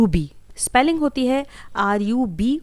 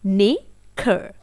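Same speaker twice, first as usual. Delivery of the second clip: नेखर stretched out very slowly; slow repeat; much slower than usual. Shown as a demonstration. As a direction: draw out नेखर slowly for the learner. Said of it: "एक बार फिर से". (नेखर बहुत धीरे)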